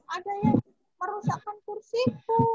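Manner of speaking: upset small bear complaint; baby bear voice. Acted. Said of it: "kursinya Baby Bear".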